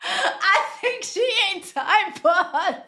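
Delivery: high, words broken by laughter; laughing hard. Real, not acted. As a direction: requote it while cracking up. Cracking up while requoting the clip.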